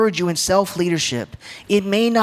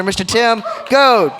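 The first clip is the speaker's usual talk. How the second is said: grand introduction; 5 semitones higher, 5 dB louder, slower than usual. Real, not acted. Introducing father to audience.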